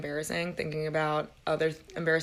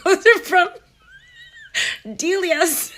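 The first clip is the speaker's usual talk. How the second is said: much higher and louder, laughing; laughing through the quote; 12 semitones higher, 10 dB louder, slower than usual. Real, not acted. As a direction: squeal the punchline while laughing. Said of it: "And she was like".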